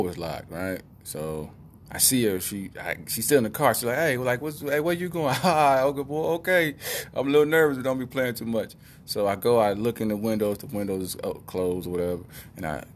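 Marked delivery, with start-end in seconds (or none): 4.0-8.7 high, anxious mock dog chatter; nervous dog voice. Voicing nervous dog left in car.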